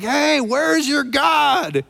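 high, slow taunting shout; jeering call across the mountain; mocking shout. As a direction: yell it out to mock them, drawn out. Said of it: "Elijah mocked them, and he cries out".

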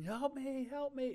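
high-pitched imitated cry for help; thin, high mock scream. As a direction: plead like a trapped crash victim, hammed-up. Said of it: "like, screaming".

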